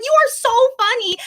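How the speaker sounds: high, loud squealing insistence; gushing insistence. Retold or imitated. Insisting he is funny.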